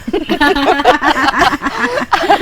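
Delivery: long high burst of laughter; real helpless laughter; amused laugh. Real, not acted. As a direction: crack up at the joke. Joke about someone familiar.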